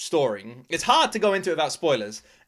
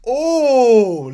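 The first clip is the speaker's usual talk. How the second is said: loud, high gasp of shock; 7 semitones higher, 9 dB louder than usual. Real, not acted. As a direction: react in sudden shock. Reacting to a shocking scene.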